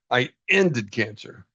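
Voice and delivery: "ended" said slowly, each word weighed; slow and deliberate. Shown as a demonstration. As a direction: set the correct word down with gravity. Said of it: "why I say it so profoundly".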